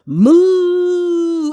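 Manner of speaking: drawn-out mock cow call; cow moo. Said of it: "Go moo".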